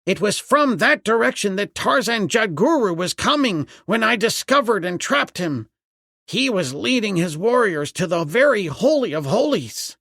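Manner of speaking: high, animated servant's voice; excited explanation. A narrator's reading. Servant realises the danger, explains excitedly.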